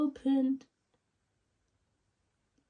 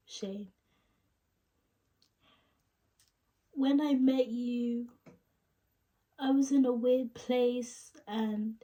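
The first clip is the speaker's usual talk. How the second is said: halting, higher tearful break-up confession; Rachel; 4 semitones higher than usual. Acted. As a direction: ache through long pauses, voice pitched up near tears. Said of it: "sad tone".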